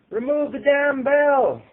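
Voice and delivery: very high, loud, slow shout; yelled out. A narrator's reading. Crowd member demanding the bell go, loud yell. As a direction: bellow it from the back. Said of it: "someone yelled out".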